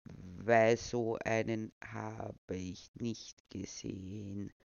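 put-on deadpan old man; dry. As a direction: deliver it bone dry. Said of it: "meinte [name] trocken".

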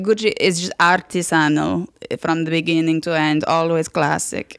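Italian fashion snob, drawn out at the end; Italian-accented connoisseur's verdict. Retold or imitated. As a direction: pronounce judgement in an Italian accent. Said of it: "an impression of him".

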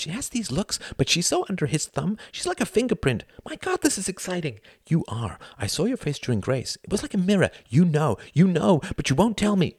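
high-pitched, eager confiding rush; breathless excitement. A narrator's reading. Youngest brother confides in a fellow non-believer, breathless excitement. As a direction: gush secretly, thrilled to find an ally.

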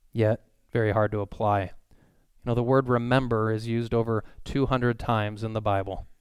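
ordinary delivery

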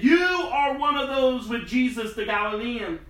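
high, insistent accusation; accusing. Acted. Servant girl accusing Peter in the courtyard, accusing.

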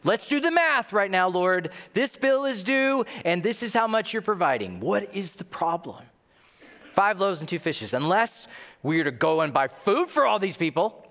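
high, exasperated sarcastic complaint; thick sarcasm. Acted. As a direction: argue the sums with pointed sarcasm. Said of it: "It's thick with sarcasm".

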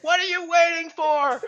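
slow, high drawn-out taunt; taunting challenge. Retold or imitated. Survivor challenging the stalled drones, slow and high. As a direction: dare them, stretching every word. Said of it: "it's like".

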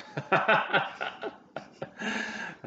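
bright embarrassed giggle, high; high sheepish laugh. Acted. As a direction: laugh it off, a little bashful. NPC laughs at his own brag.

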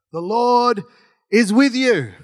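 loud, high, drawn-out greeting; ringing angelic announcement; emphatic proclamation. Acted. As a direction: proclaim it grandly with a pause after LORD.

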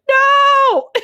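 high, loud screamed outburst; retold scream. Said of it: "and I just scream".

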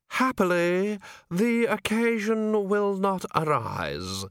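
high, slow, bone-dry voice; dust-dry dead voice. A narrator's reading. Voice in the shadows cutting Vimes off, dust-dry and slow. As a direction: sound long dead, no spittle in the mouth. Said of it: "It was dust dry".

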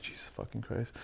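very quiet swearing aside; muttered exasperation. Retold or imitated. Man interrupted by babbling date in bathroom, muttered exasperation. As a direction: drop it right down under your breath. She barges in while he pees.

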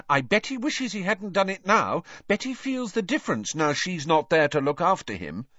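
high, pointed indignation; cold indignation. A narrator's reading. Hermione siding with the sacked elf, coldly indignant. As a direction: press the point, pitched well up. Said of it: "said Hermione coldly".

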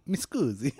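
high hammy Italian apology; a playful Italian voice; mock Italian accent.

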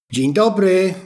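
called greeting. Acted. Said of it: "zawołała".

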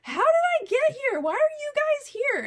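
put-on high, loud, bewildered drunk voice; mock-drunk high-pitched questioning; imitating drunk friend. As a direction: wail it like a confused drunk. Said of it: "starts stumbling into the room".

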